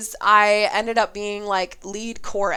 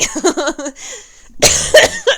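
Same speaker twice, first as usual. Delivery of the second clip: laughing; 10 semitones higher, 9 dB louder than usual. Real, not acted. Laughing after the voice.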